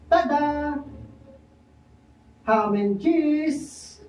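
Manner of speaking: high, slow showman's reveal; proud reveal. Acted. Juan grandly reveals his sandwich to Pedro, high and slow. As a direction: unveil it grandly.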